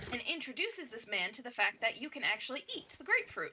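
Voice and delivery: ordinary talk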